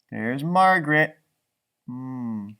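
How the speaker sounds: ordinary delivery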